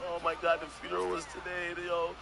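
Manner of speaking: imitated tearful lament; mock crying. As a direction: act out grief, only lightly tearful. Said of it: "And he's crying".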